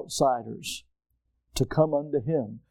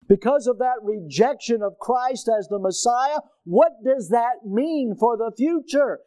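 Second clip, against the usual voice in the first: higher, louder, driving the point home; raised pulpit voice building to a cry; full-force preaching; 7 semitones higher, 3 dB louder than usual. Acted. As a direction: preach it out over the congregation.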